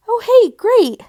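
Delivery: high, loud burst of delight; exclaimed. A narrator's reading. Stacy thrilled to join the club, high loud exclaim. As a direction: blurt it out, thrilled. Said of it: "Stacy exclaimed".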